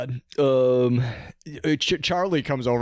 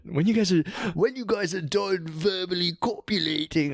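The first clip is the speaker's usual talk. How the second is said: put-on a rough try at a Manchester accent, pitched up; 8 semitones higher than usual. Charlie in a Manchester accent, telling the flirting pair to stop. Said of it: "I don't know how to do a Manchester accent".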